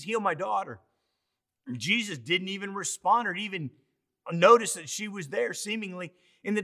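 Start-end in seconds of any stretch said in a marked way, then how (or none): none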